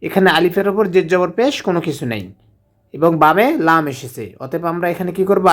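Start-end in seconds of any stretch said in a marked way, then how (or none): none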